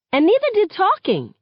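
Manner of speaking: high, loud, sharp retort; snapping. A narrator's reading. Girl answering her friend's caution, high angry snap. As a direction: snap back in anger. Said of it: "I snap".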